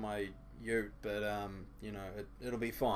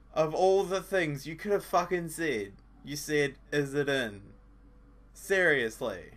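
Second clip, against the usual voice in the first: louder, exasperated ranting; 4 semitones higher, 9 dB louder than usual. Real, not acted. Commentator ranting at the game's line, loud. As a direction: vent at the screen in disbelief.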